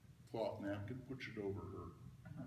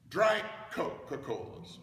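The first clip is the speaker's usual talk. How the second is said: German "drei" ordered; 6 semitones higher, 8 dB louder than usual. Retold or imitated. Father orders drinks.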